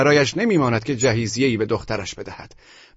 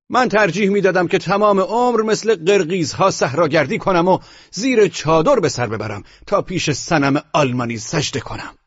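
fiery retort, pitched higher; heated; 6 semitones higher than usual. A narrator's reading. Narrator provoking the general and the Frenchman, heated and high. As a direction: fling it back with passion, much higher. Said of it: "با حرارت بسیار گفتم".

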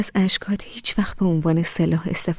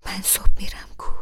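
whispered. A narrator's reading. Husband whispers goodnight into wife's ear, hushed. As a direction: breathe it into her ear. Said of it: "توی گوشم زمزمه کرد".

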